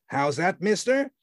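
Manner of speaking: mister jumps high, rising; nasty demand. A narrator's reading. The man challenging Grand, high and nasty. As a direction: demand it with a frown. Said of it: "demanded the latter with a nasty frown".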